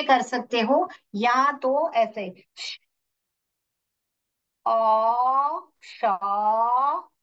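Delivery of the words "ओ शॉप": शॉप stretched out very slowly; slow blending. Shown as a demonstration. Teacher slowly blending shop for pupils. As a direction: drag शॉप out sound by sound. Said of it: "ऐसे पढ़ने का".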